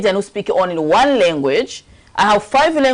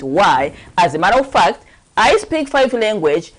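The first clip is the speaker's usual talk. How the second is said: ordinary delivery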